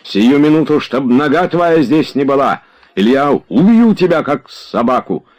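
high, forceful outburst of rage; furious threat. A narrator's reading. Oblomov threatens to kill Tarantyev, in a rage. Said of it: "трясясь от ярости".

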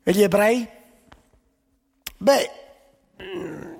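ordinary talk, nothing marked

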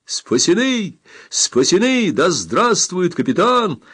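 high, jubilant cheer; joyful cry. A narrator's reading. Two reunited squads hail their captain, cheering. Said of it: "Крик радости вырвался у всех".

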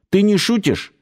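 high-pitched, incredulous question; astonished. A narrator's reading. Alexei doubts the guide is serious, astonished. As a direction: ask in disbelief, pitched well up. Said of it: "изумился Алексей".

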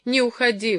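understated imploring tone; pleading. A narrator's reading. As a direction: beg him to stay. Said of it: "взмолилась Джоан".

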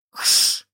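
sharp voiceless shushing hiss. A narrator's reading. Woman hushes the man, hissing. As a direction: hiss to silence him. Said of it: "прошипела на Нихолта".

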